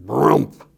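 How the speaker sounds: loud booming mouth noise; imitated gun burst. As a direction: blurt out a heavy gunfire boom. Imitating rapid gunfire sound.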